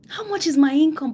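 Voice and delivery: a hint of alarm, said up high and loud. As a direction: lift the question high and push it out loud.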